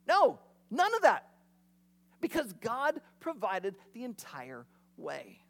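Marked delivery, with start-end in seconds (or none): 0.1-1.3 emphatic no. Real, not acted. Emphatic denial after mock complaint.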